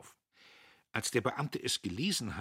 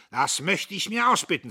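indignant retort; 11 semitones higher, 11 dB louder than usual. Acted. Dietrich bristles.

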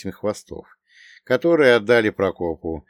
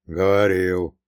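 grumbled; 3 dB louder, slower than usual. A narrator's reading. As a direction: concede it with a short grumble. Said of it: "буркнул Прокоп".

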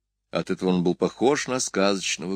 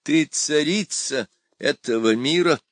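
ordinary delivery, nothing marked

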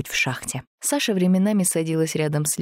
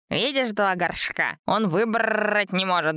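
slow, gentle sympathetic voice; compassionate; 4 semitones higher than usual. A narrator's reading. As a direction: speak with pity for the plant. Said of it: "услышала в голосе Антона сострадание".

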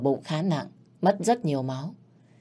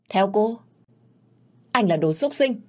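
angry insult; 6 semitones higher than usual. Acted. Woman insults man she suspects.

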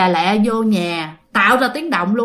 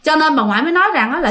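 ordinary delivery, nothing marked

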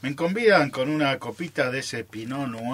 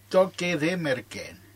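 ordinary talk, nothing marked

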